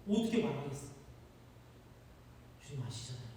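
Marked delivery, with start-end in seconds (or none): 2.6-3.3 much softer, resigned Peter; ashamed hush. Acted. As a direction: murmur it, out of words. Peter answers third time.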